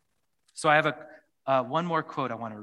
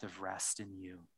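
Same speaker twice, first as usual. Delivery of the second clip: prayer. Acted. Leads closing prayer.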